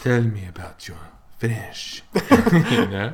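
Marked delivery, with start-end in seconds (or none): 0.0-2.0 very slow, lightly voiced swooning request; a hint of a smitten monolingual, slowed right down; mock dreamy plea. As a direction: drag it out like a starstruck fan. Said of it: "and they're like".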